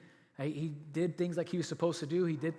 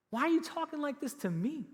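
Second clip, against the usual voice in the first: much higher, rising on you; incredulous question; 10 semitones higher than usual. Retold or imitated. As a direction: repeat it in high disbelief. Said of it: "And then he says this".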